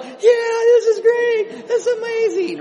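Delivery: very high, loud laughing dog voice; happy dogs. Pretend. Preacher voicing his dogs as still thrilled, very high and loud. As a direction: shout the dog's delight very high with a laugh. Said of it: "they're still like".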